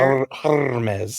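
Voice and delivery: Hermes with a drawn-out rolled R; tongue-rolled French. Shown as a demonstration. Co-host showing off the French designer name, tongue rolling on Hermes. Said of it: "Remember my tongue rolling with the French name".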